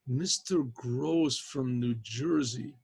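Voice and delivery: drawn-out, puzzled repeat of the name; slow pondering. Retold or imitated. Professor tries to place the collect caller, pondering slowly. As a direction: stretch each word while searching your memory.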